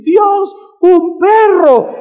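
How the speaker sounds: high, loud shriek of delight; children's excited shout. Retold or imitated. Children at the gift box, shrieking with delight. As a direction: scream it out like thrilled kids.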